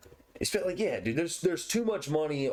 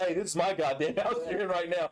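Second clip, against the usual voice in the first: higher cocky brag; ready-to-scrap mock warning; playful turf claim; mock defiant fighter; 6 semitones higher than usual. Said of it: "be like".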